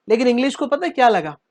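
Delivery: usual speaking voice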